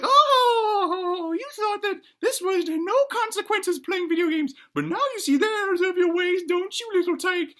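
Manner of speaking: very high falsetto granny, loud whooping start; gloating granny. Acted. Granny lectures the boy on the consequences of games, gloating. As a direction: crow over the kid as granny. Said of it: "And the granny's like".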